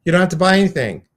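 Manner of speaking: high, loud and fast; excited announcement. Real, not acted. Host telling the caller she won, high, loud and fast. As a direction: burst out with the good news.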